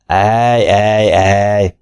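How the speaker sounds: a touch of gruff tutting; slightly gruff. A narrator's reading. Granville tuts at Jim's half-full glass, lightly gruff. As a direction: tut at him, lightly gruff. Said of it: "mondta kissé érdesen".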